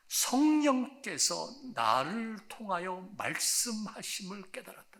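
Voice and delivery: usual speaking voice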